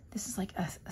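soft whispered aside. Real, not acted. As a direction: breathe it, no voice. Flip-through, whispering aside.

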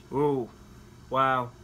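ordinary talk

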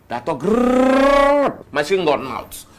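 loud, high-pitched tirade with drawn-out grrr; ranting insults. Acted. Husband mocks his wife's mouth, ranting loudly.

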